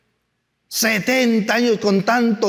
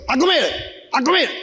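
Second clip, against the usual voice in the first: higher, pushy order to eat; bossy supervisor; 5 semitones higher, faster than usual. Retold or imitated. Chocolate factory supervisor urging a worker to keep eating, high and bossy. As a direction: push him to eat, chirpy and insistent.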